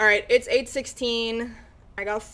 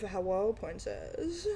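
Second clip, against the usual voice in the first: much softer put-on greeting; soundboard voice; 3 semitones lower, 10 dB quieter than usual. Streamer pitching a soundboard, a soft breathy voice. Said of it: "One of".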